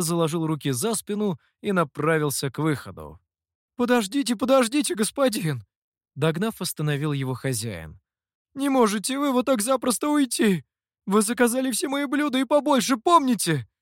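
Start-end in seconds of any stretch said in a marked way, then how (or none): 3.8-5.6 high, hurried plea to stop; high-pitched urgent appeal; urgent calling after. A narrator's reading. Innkeeper calls after departing guest. 8.5-13.7 high-pitched, insistent complaint; worked-up innkeeper, pitched high; agitated protest. A narrator's reading. Innkeeper protests guest leaving unpaid.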